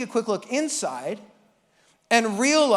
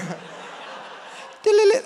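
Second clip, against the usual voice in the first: imitated guitar riff; 7 semitones higher than usual. Imitating his guitar solo.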